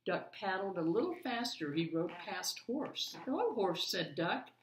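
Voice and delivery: put-on lively read-aloud for children; story narration. A narrator's reading. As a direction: read it like a bedtime picture book. Reads picture book aloud.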